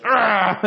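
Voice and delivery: high mock straining groan; acted high grunt of effort. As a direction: imitate a cartoon kid bearing down. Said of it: "sticks his ass out ... and goes".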